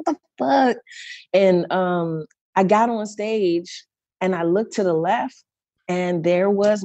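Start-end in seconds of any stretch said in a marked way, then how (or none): 0.1-0.8 high-pitched mock exasperation; retold disbelief. Singer retelling her reaction to added performers, retold disbelief. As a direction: voice your inner disbelief, pitched up.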